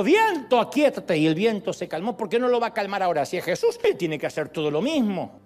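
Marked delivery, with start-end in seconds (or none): 0.0-1.2 high, loud command to the wind; commanding Jesus. Retold or imitated. Jesus commanding the wind, lightly voiced. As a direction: order the storm to be still. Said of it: "lo despertamos y dijo".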